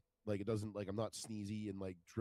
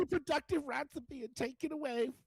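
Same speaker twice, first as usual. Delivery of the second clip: high falsetto mocking a protester; 4 dB louder than usual. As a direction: squeak out the slogan in a high, jeering falsetto.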